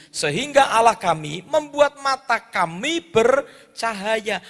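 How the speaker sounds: loud, measured Bible reading; scripture reading. Acted. Preacher reads Ezra aloud to the congregation, loud and solemn. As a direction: proclaim the verse to the congregation.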